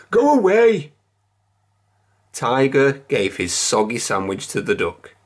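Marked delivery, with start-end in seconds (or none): 0.1-0.9 shooing. A narrator's reading. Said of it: "said Kipper".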